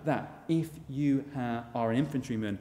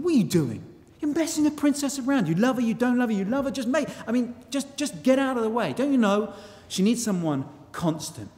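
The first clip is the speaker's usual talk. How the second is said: high, louder indignant knight telling his cousin off; indignant rival; 7 semitones higher, 4 dB louder than usual. Retold or imitated. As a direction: scold him, pitched up and pushy. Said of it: "he says".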